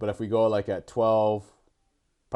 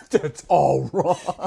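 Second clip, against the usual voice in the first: slow, higher, louder teasing echo through laughter; mock repeat, laughing; 6 semitones higher, 7 dB louder, much slower than usual. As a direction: drag it out, laughing at the trainer. Friend mockingly repeats trainer's line.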